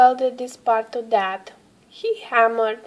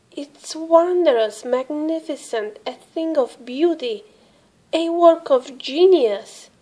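louder, higher, swelling praise; gushing; 5 semitones higher, 5 dB louder than usual. A narrator's reading. Santa praising Russell's sleigh, gushing and louder. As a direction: gush over it, building up. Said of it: "Santa gushed".

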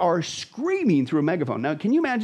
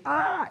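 short high mock scream; quick high-pitched cry, imitating terror; imitated scream; 11 semitones higher than usual. Host imitates souls leaving the earth screaming, high mock scream. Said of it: "leaving the earth screaming".

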